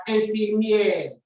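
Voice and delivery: "infirmière" said slowly with a nasal "in"; teacher reading "infirmière" slowly; slow French word. Shown as a demonstration. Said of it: "Let's go to the next one".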